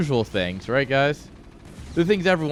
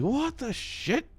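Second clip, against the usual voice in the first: mock disbelief; 13 semitones higher, 7 dB quieter, slower than usual. Retold outrage at donut prices.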